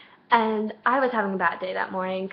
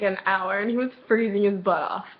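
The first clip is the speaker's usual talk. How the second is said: ordinary talk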